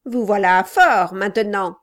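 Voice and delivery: a loud, pushy little man; gruff gravelly dwarf voice. Acted. Quilp continues.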